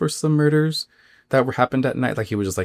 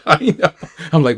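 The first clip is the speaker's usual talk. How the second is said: laughing through the words; 8 semitones higher, 6 dB louder than usual. Real, not acted. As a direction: chuckle it out. Laughing while agreeing with listener.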